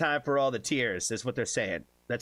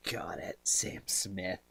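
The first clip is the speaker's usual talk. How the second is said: softly whispered to himself; 6 semitones lower, 6 dB quieter, much slower than usual. Real, not acted. Streamer whispers the request to himself. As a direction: breathe it, no voice.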